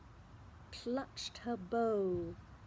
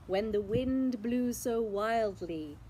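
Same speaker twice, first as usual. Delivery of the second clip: plain reading voice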